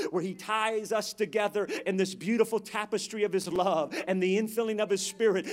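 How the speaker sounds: usual speaking voice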